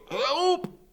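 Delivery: high, panicked cry for help; a frightened yelp for rescue; high, scared yelp; blubbering cry for help. Acted. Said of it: "He's blubbering".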